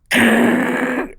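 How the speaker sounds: high imitated engine screech; spaceship take-off noise. Driver speeding away from police, imitated zooming noise. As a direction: imitate a car shooting off like a spaceship. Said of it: "like a goddamn spaceship".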